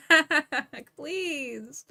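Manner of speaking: usual speaking voice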